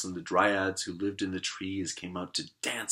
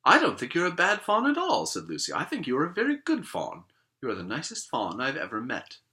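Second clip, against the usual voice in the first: Lucy voice; 6 semitones higher, 4 dB louder than usual. Acted. Lucy reassuring the faun.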